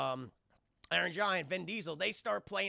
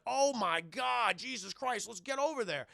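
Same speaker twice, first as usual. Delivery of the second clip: mild alarm; 4 semitones higher than usual. Retold or imitated. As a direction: imitate someone stunned by the sight. Retold alarm at sighting.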